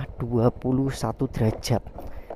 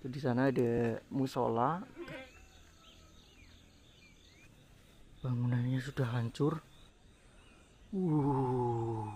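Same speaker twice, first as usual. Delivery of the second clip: hushed; 15 dB quieter, slower than usual. Real, not acted. Whispering in an abandoned village.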